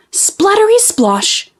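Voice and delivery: high playful splattery splosh; splat sound. A narrator's reading. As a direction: fling the sound out high and silly. Pigeon's dropping sound.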